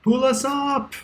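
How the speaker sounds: high, loud holler; high-pitched shout up a shaft; calling up. Acted. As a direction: yell up for help from far below. Calling up from below in character.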